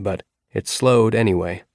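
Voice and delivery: ordinary delivery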